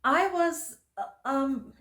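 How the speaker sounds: stammering, halting start; stuttering. Acted. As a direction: stumble over the words. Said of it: "she stuttered".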